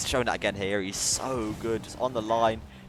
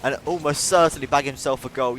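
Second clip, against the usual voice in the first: louder, raised excited call; excited play-by-play; 6 semitones higher, 10 dB louder than usual. Real, not acted. Caster calls Shallow's break to the other end, excited. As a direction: build excitement as the attack breaks away.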